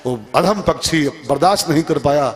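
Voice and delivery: ordinary talk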